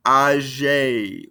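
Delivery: "Âgé" ending on a clear "ay" vowel; sounded final E. Shown as a demonstration. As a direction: voice the accented E of "Âgé". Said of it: "makes us pronounce that E".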